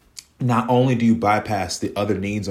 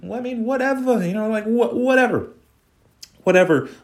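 dismissive whatever; 13 semitones higher, 3 dB louder than usual. Real, not acted. Dismissive of the quarterback.

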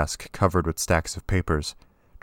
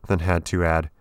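plain reading voice

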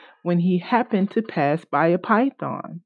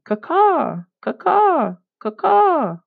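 crow cawing; 11 semitones higher, slower than usual. Acted. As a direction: call the alarm like a crow. Crow crying alarm.

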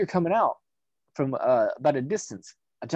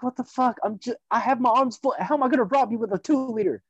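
higher, mock-outraged protest; indignant disbelief; 8 semitones higher than usual. Accused of robbery while holding snacks, mock outrage. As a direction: sound baffled and offended.

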